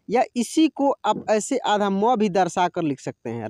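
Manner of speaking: ordinary talk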